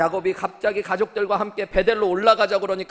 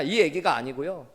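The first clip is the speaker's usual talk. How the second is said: ordinary delivery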